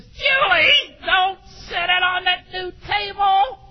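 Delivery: high, loud mock yell; imitating a shout at daughter. Father yelling at daughter over the new table, high mock shout. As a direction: bark it like a startled dad. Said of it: "exactly the way I said it".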